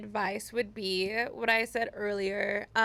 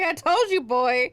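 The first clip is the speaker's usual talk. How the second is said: told-you-so tease; 3 semitones higher, 8 dB louder than usual. Retold or imitated. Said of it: "You're like".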